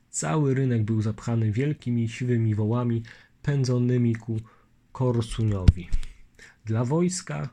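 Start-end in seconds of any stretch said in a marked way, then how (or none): none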